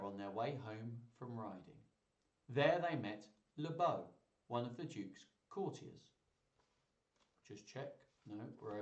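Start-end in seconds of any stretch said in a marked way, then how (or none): none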